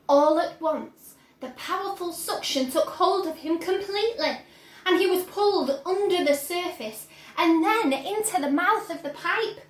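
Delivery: usual speaking voice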